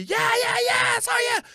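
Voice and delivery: high shouted cheers; yelling. Retold or imitated. Fan shouting at the TV, high and excited. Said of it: "I'm yelling".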